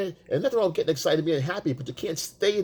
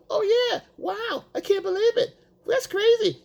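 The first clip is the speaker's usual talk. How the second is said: much higher, over-the-top wow; mock amazement. Boyfriend overreacting to her story, much higher mock amazement. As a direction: squeal with fake amazement.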